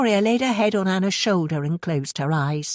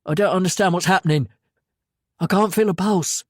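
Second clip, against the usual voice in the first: flustered panic; 3 semitones higher than usual. A narrator's reading. Reporter panics doing CPR.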